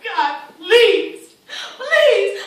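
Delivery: loud, anguished pleading; impassioned plea, pitch climbing; desperate prayer. Acted. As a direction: beg God at full voice. Mother pleads with God.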